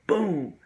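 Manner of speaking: high, punchy sound-effect boom; sudden-impact boom. Retold or imitated. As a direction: burst it out as the high hits. Imitates the sudden hit.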